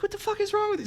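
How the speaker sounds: squeaky high imitation of an appalled girlfriend; incredulous girlfriend. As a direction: scold in disbelief, way up in falsetto. Voices girlfriend's shocked reproach.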